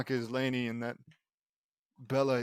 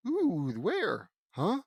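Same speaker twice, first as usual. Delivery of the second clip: high, questioning "huh"; distracted, then puzzled; confused; 4 semitones higher, 5 dB louder, much slower than usual. A narrator's reading. Sister falls for the shiny object trick, confused.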